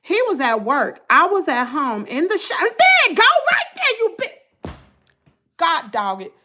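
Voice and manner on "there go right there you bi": much higher and louder, calling at the dog; yelling at dog. Real, not acted. Interrupting the reading to yell at her dog, a high loud call. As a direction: shoo the dog off sharply.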